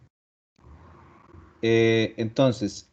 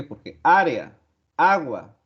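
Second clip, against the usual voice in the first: ÁREA and AGUA hit hard on the opening A, then falling; stress on first A; 3 semitones higher, slower than usual. Shown as a demonstration. Teacher showing students the tonic vowel, first-syllable stress. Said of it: "la sílaba más fuerte".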